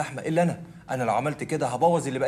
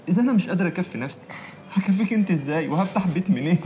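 raised, higher, anguished questions; frustrated despair; 6 semitones higher, 4 dB louder than usual. Acted. Suitor telling his fiancée he cannot provide, despairing. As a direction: protest helplessly with raised voice.

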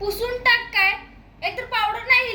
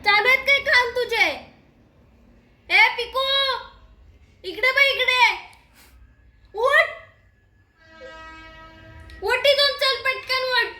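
scolding; 4 semitones higher than usual. Real, not acted. Ordering the dog to get up.

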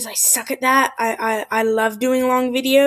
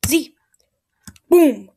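ordinary delivery

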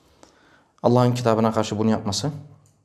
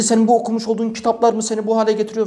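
high, fast scolding rant; angry yelling; 8 semitones higher, faster than usual. Retold or imitated. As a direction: fire off the accusation. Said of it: "Böyle bağırıyor". (hızlı ve öfkeli azarlama)

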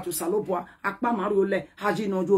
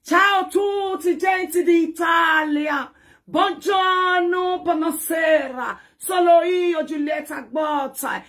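mock Italian; 4 semitones higher, much slower than usual. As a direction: ham up a drawn-out Italian greeting. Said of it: "Italian people".

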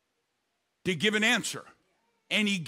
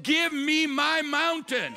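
high, drawn-out preacher's cry; bold demand; 8 semitones higher, slower than usual. Acted. Preacher voicing Joshua and Caleb claiming their land, bold cry. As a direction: claim it boldly, stretching the words.

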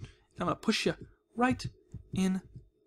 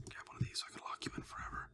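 fast conspiratorial whisper; 18 dB quieter, much faster than usual. Acted. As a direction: hush it like a secret plan. Whispered scheme, then mock surprise.

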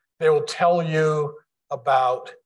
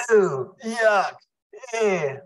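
mock disgust; 7 semitones higher, much slower than usual. People seeing a placenta, higher mock disgust. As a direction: pull back from something gross. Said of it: "they go".

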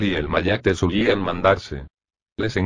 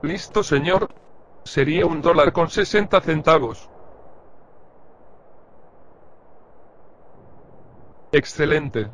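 higher, eager cashier's voice; friendly shopkeeper; 8 semitones higher than usual. Acted. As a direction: serve the customer brightly. (voz aguda de cajero amable)

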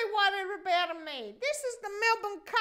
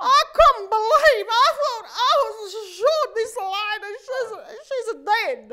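emotional; 10 dB louder than usual. Retold or imitated. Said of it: "I started to cry".

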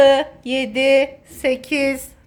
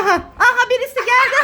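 excited; 7 semitones higher, much faster than usual. Real, not acted. Spotting child, excited.

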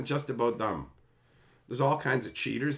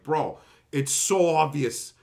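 ordinary delivery

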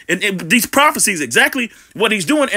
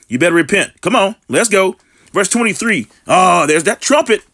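usual speaking voice

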